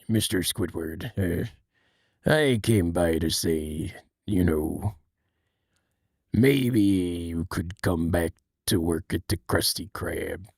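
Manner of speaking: low, soft, earnest crab boss; deep and serious, coaxing an employee back; serious Mister Krabs. Retold or imitated. Mister Krabs gently asking Squidward back to work, low. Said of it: "I can really only do Mister Krabs when he's talking".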